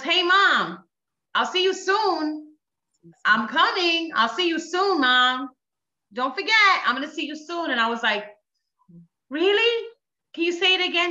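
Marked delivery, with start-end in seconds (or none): 0.0-7.7 put-on high, singsong child's voice; son on phone. Dream son tells mom he's coming, high child voice. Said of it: "he gets back on the voice and he says". 9.3-11.1 high-pitched, wondering question; retold disbelief, voice lifted high; amazed asking. Mother asks dream son to repeat it, high wondering voice.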